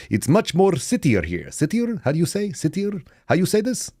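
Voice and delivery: put-on high, halting foreign student accent; fake Kazakh accent. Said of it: "I claimed to be a foreign exchange student".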